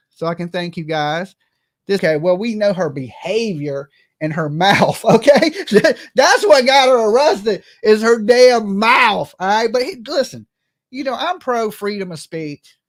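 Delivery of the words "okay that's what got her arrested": ordinary talk, nothing marked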